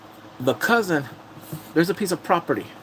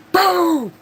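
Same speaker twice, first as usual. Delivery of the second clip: loud, high shouted "boom"; big, high-pitched blast noise; imitated impact boom; 7 dB louder than usual. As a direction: punch it out as a comic sound effect. Imitates the punch landing.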